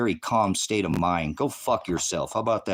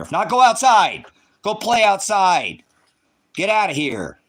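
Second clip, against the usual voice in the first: loud, high, slow shout of a scolding parent; booming parental order to go play outside; parent yelling; 9 semitones higher, 7 dB louder than usual. Retold or imitated. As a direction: yell like a parent sending kids outside. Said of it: "That's how I was raised growing up".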